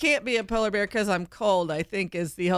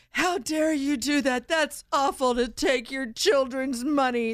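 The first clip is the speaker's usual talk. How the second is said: outraged; 5 semitones higher, slower than usual. Retold or imitated. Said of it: "And they're like".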